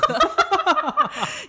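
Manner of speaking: loud high laugh; high burst of laughter. Real, not acted. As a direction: crack up out loud. Laughs at her own story. (高声爆笑)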